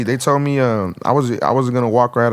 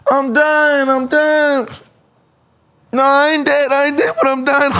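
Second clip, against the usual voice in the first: much higher, hammed-up dying whine; mock the friend's panicked wailing, pitched way up; wounded friend's wail; slower than usual. Imitating his shot friend wailing in the car, high mock panic. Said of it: "he did the funniest shit".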